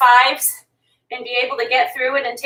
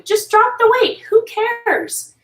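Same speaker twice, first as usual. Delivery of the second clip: excited; 10 semitones higher, slower than usual. Real, not acted. Urging the viewer to keep going.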